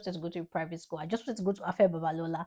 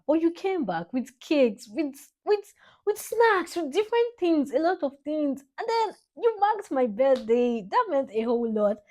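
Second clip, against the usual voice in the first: rising, louder, thrilled recollection; excited; 9 semitones higher, 5 dB louder than usual. Real, not acted. Daughter telling dad about birthday cakes, excited. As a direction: gush about the cakes he brought. Excited recalling dad's surprise.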